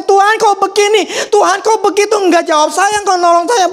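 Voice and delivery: high, pleading gripe at God; mock the grumbling believer, pitched high; whiny complaining prayer. Preacher mimics a believer grumbling at God, whiny and high. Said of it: "Bukan ngomongnya".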